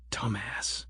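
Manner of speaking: soft muttered insult; under my breath. Acted. The narrator insults Grant under his breath, soft and muttered. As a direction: mutter it only to yourself. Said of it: "I said under my breath".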